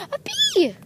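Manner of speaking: high shriek at a bee; startled scream. Real, not acted. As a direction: jump at the sight of a bee. Sudden bee scare.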